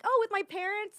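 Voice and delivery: high-pitched feigned innocence; playing innocent girl. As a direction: imitate a young girl answering innocently. Playing young girl to deter man.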